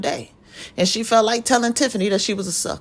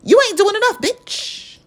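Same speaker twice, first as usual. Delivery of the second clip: sassy high retort; 10 semitones higher than usual. Acted.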